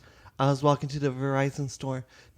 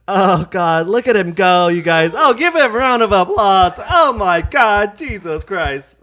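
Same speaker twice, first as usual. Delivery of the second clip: excited cheering; 3 semitones higher, 8 dB louder than usual. Real, not acted. Cheering a push-up feat.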